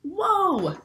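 high, bright cry of wonder; amazed whoa. Acted. Book character marvels at the busy brain, high amazed whoa. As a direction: gasp it out in pure amazement.

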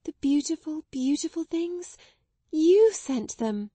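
overflowing with grateful amazement; joyful emotional voice. A narrator's reading. Girl thanking the Indian gentleman, high and overjoyed. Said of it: "in a joyful, emotional little voice".

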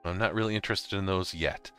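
ordinary talk

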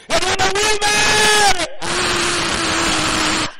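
long, high, very loud acted scream; screaming from hell. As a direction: scream out like a tormented soul in hell. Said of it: "va a estar gritando".

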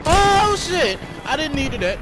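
high, loud startled yelp; yelling in sudden shock; startled shout. Real, not acted. Sudden grenade blast in game.

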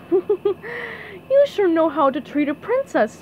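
high, slow pleased compliment; a lightly voiced, gracious princess. A narrator's reading. Princess thanking SpongeBob for napkins, high and pleased.

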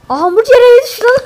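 high, loud startled yell; surprised shout. Real, not acted. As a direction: blurt it out in shock. The hamburger house suddenly falls.

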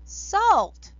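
loud, high startled cry; surprised shout. Acted. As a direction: shout it in shock. Old man discovers salt, exclaims.

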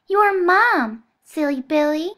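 put-on high, loud childish coaxing; little girl voice. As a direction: call him along like a child. Bonesaw teasing.

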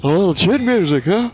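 high, loud imitation of a broadcaster; broadcaster impression. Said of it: "Doing an impression?".

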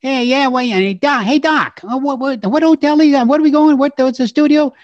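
high, loud Brooklyn cabbie chatter; Brooklyn cab driver. Retold or imitated. Brooklyn cabbie grilling his fare, high and pushy. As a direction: pester the passenger like a Brooklyn cabbie. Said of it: "and he kept saying".

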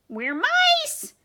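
very high, loud squeal; squeaking mice. Acted. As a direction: squeal it all together as mice. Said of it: "they squeaked".

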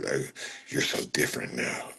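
breathy mocking whine, no voice; mock chatter imitating a clingy fan; whispered whiny mock. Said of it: "mocking chat, whispery".

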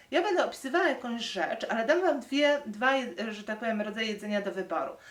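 ordinary delivery

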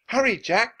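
high, loud hurrying call; urgent cry. A narrator's reading. Annie hurries Jack from the ladder, crying out. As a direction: cry out to rush someone. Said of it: "cried Annie".

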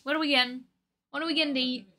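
normal talking voice

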